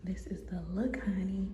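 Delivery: sassy whisper, rising on look; hushed outfit reveal to the camera; whispered show-off. Real, not acted. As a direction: whisper it like a proud little reveal. Showing off her outfit.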